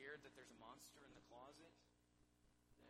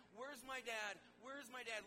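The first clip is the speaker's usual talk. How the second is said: put-on panicked lost child; 7 semitones higher, 9 dB louder than usual. Lost child at fair calling.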